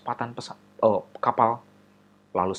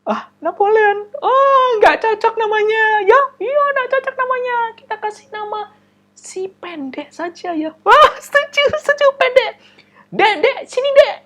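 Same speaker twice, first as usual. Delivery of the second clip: mocking classmates; 11 dB louder than usual. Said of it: "ketawa mereka semua".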